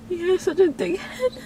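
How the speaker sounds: real sobbing, high strained voice; high-pitched, tearful self-reproach; crying. Real, not acted. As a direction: cry through the words slowly, sobs breaking in between. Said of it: "I can't believe I cried again".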